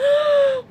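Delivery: high, crying gasp of sudden realization; shocked, sobbing intake of breath; sharp gasp. Retold or imitated. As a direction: gasp as it hits her. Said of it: "started breaking down in the street".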